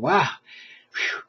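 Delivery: usual speaking voice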